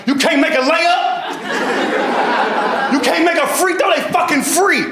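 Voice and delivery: high, slow scolding yell; mom cursing out. Retold or imitated. Mother yelling at her son over missed free throws. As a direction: chew him out like a furious mom. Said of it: "she came in cursing me out like".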